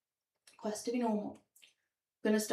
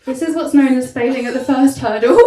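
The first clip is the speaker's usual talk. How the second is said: much louder, laughing on the last words; louder retry; 3 semitones higher, 14 dB louder than usual. Real, not acted. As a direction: hit the whole line hard while laughing. Repeated punchline, louder.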